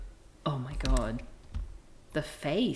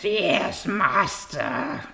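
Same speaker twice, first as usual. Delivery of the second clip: louder, otherworldly demon cat; 2 semitones higher, 6 dB louder, much slower than usual. Acted. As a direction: snarl it like a possessed cat servant. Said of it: "Demon cat voice".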